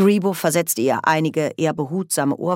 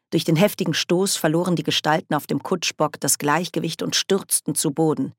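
ordinary delivery, nothing marked

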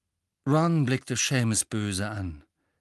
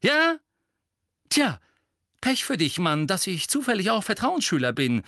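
angry retort; 8 semitones higher, 4 dB louder than usual. A narrator's reading. Ron retorts angrily to Seamus.

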